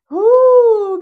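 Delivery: high, loud, drawn-out "ooh"; high sustained hoot; exclaimed ooh. Real, not acted. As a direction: let out a long whooping ooh. Exclaims at the long story.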